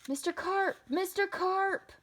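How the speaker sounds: high, anxious calling out; yell. A narrator's reading. Boy calling to Mister Carp underwater, high yell. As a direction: call the name out, longer the second time. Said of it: "I yelled".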